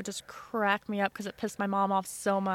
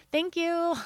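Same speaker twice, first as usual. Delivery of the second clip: high, sing-song little thank-you; pitched-up innocent thanks; sweet thanks; 8 semitones higher than usual. Retold or imitated. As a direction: play a smug grandkid thanking grandma. Child thanking grandma in retold call.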